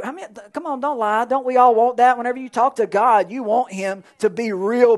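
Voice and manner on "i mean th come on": normal talking voice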